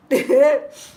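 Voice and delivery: a loud, high burst of laughter. Real, not acted. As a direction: laugh at yourself. Laughs at herself.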